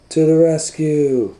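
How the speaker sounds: high, loud superhero call; playful rescue cry; heroic cry. Acted. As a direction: shout it like a caped hero. Playing Captain Plunger.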